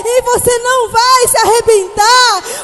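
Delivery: desperate father. Acted. Father running to rescue his frightened son, shouting. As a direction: cry out to a scared child in anguish. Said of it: "o pai sai lá correndo desesperado".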